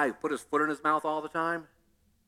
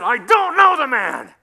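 louder slow angry denial; cursing denial; 6 semitones higher, 8 dB louder, slower than usual. Retold or imitated. Peter cursing at accusers, loud and slow. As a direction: spit out the denial. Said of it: "he cursed them".

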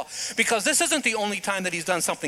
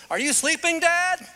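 lightly voiced child, higher; a hint of a nosy child; kid waking dad; 10 semitones higher, 3 dB louder than usual. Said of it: "when somebody goes".

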